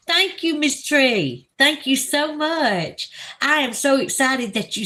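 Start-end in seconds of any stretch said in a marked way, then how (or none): 0.1-3.1 gushing thanks. Real, not acted.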